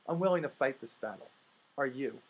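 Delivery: ordinary talk, nothing marked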